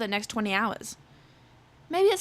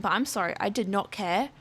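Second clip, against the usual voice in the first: normal talking voice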